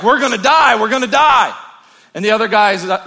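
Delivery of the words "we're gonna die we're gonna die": high, loud, frantic wail; panicked cry. Acted. Stranded man panicking to his friend, loud frantic wail. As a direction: cry it out like you're doomed. Castaway panics after plane crash.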